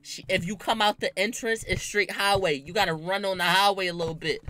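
ordinary talk, nothing marked

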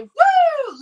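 high, loud slow whoop; reading Chad's cheer as a big whoop; woo. Pretend. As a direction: stretch the woo out.

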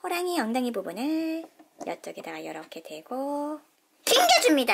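ordinary delivery

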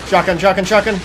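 calling out in a panic; urgent shouting. Real, not acted. Urgent calls in combat.

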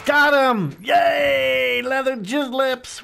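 high, loud victory shout; triumphant shout. Real, not acted. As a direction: yell it out, thrilled to win. Gets the kill, celebrates.

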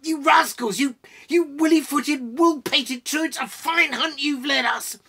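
loud, high-pitched ranting tirade; indignant outburst. A narrator's reading. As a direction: scold the rascals at full voice. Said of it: "cried Gimli, unable to contain himself".

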